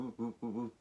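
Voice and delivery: soft rising whoo tones; rising detector whoo. Shown as a demonstration. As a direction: hoot like a detector beep. Imitating the good target tone.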